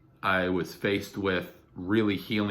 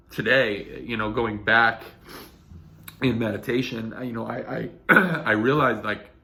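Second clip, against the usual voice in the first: raised, strained with held-back tears; emotional voice breaking; 3 semitones higher, 5 dB louder than usual. Real, not acted. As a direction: push through the tears.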